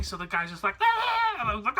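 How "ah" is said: high, loud wordless holler; imitated yell. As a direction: mimic a man shouting over propellers. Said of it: "it was so loud you just couldn't hear".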